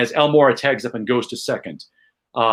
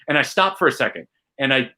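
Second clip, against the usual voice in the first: normal talking voice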